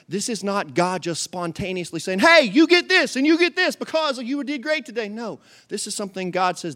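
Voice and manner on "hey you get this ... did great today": high, loud breezy giveaway voice; loud high mock cheer; mock casual God. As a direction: hand out prizes like a game show host. Mock casual God handing out rewards.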